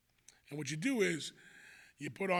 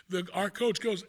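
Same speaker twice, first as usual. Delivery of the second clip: ordinary delivery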